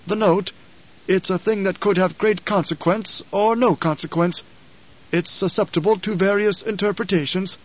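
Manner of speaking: put-on measured report from an elderly aide; careful old advisor. A narrator's reading. Narrator voices old advisor.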